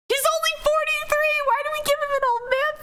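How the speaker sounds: squeaky disbelief, very high pitch; high laughing outrage. Real, not acted.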